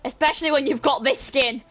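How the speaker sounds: ordinary delivery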